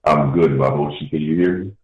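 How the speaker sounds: echoey phone line; echoing call; echoing tunnel. Real, not acted. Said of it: "You sound like you're in a, in a tunnel".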